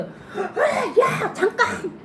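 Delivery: very high urgent cry of wait; sudden shout. Acted. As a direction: yell to stop him mid-act. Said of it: "소리 질렀겠죠". (급하게 "잠깐!" 하고 외치는 소리)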